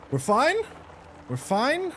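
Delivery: high sing-song "We're fine" to calm herself; self-reassuring. Real, not acted. Gamer soothing herself mid tricky level, high lilting reassurance. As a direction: talk yourself down in a lilting high voice. Calming self during hard part.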